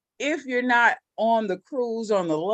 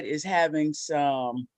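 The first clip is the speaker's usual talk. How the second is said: ordinary talk, nothing marked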